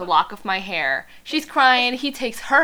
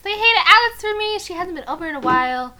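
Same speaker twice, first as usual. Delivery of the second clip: mock Charlie; 10 semitones higher, 6 dB louder than usual. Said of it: "He's like".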